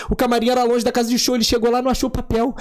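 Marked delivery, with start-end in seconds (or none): none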